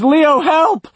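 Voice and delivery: very high, loud desperate cry; scream for help. A narrator's reading. Woman screaming to Leo for help, high desperate scream. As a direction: scream it out, pitch soaring. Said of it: "She screams".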